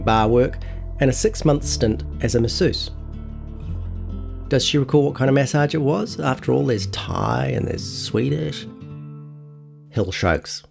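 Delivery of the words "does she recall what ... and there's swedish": ordinary talk, nothing marked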